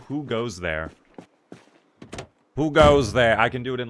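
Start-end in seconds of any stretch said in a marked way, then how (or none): 2.6-3.4 loud, hammed-up medieval sentry. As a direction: call it out like a guard's challenge. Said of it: "like, a medieval voice".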